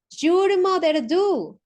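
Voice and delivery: emphatic, slow teacher's model of "your mother do?". Shown as a demonstration. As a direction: stress "your mother do?" loud and slow to finish the question. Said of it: "Podríamos cambiarlo también".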